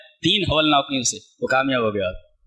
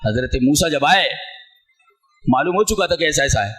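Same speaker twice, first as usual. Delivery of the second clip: ordinary talk, nothing marked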